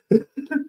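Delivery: a short high laugh at a slip; sheepish giggle; high embarrassed laugh. Real, not acted. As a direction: laugh off the flubbed word.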